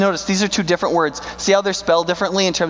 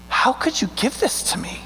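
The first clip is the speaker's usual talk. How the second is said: awed whisper; 7 semitones higher, 3 dB quieter than usual. Retold or imitated. Grateful attitude quoted in awe.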